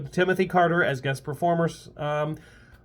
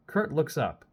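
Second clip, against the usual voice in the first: plain reading voice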